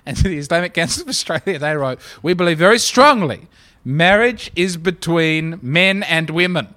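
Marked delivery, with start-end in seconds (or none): 2.2-6.7 slow, louder read that leans on "men"; stress on men. Retold or imitated. Quoting the Islamic Council's letter with a slow, louder stress on "men". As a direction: land hard and slow on "men" so the plural is heard. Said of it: "The plural being very important".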